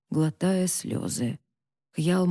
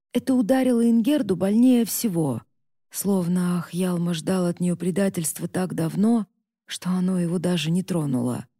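ordinary delivery

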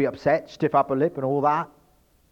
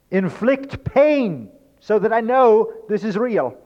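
pleading; 10 semitones higher, 7 dB louder, slower than usual. Pretend. Voicing someone begging to feel.

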